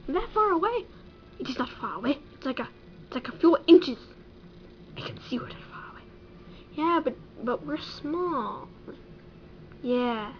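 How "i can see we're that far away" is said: fast breathless whisper. Acted. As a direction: hiss it fast under the breath. Whispered aside.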